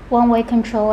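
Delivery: ordinary talk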